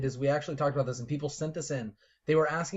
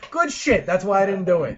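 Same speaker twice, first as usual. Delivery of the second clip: laughing; 6 semitones higher, 9 dB louder than usual. Real, not acted. Joking reaction.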